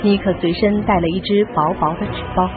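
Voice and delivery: usual speaking voice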